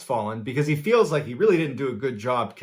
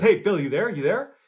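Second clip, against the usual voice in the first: higher-pitched, urgent check on a friend; retold calling out; 7 semitones higher than usual. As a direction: act out calling to someone on the line. Friend checks caller after chaos.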